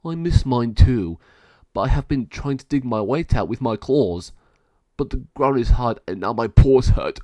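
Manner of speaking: sore-pawed dog grumbling, loud; second caged dog. A narrator's reading. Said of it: "Caged dog two says".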